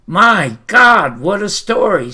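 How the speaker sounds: loud, high burst of real excitement; excited outburst. Real, not acted. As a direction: blurt it out, thrilled by the book. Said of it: "So, so really excited".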